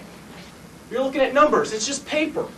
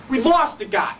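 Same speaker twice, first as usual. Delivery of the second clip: raised, upset exclamation; anguished outcry; 3 semitones higher, 3 dB louder, slower than usual. Acted. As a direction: cry it out, hitting "lost". Lieutenant's words repeated with anguish.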